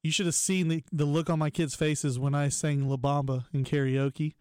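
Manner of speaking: ordinary talk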